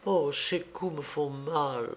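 soft, slowing, pained little voice; faint, plaintive complaint from a frightened woman; faltering, fearful voice. A narrator's reading. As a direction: confess fear weakly, drawing the words out. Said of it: "bégaya Coralie".

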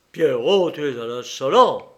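loud, angry rebuke; furious. A narrator's reading. Enraged king calling Pierrot to order, furious rebuke. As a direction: scold him loudly. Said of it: "se mit dans une colère furieuse".

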